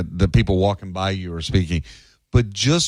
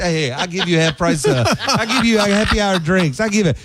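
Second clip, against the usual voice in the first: put-on higher, louder pushy Little Italy hawker accent; New York Italian accent; 9 semitones higher, 3 dB louder than usual. A Little Italy sidewalk hawker pulls in passersby, higher and pushy in a New York Italian accent. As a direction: sell lunch hard in a thick New York Italian accent. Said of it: "Guys, strong accents".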